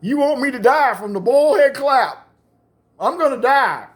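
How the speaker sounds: high, loud mock wailing; tearful whine. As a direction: whine as a betrayed friend near tears. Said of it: "a little tear fell down his eye".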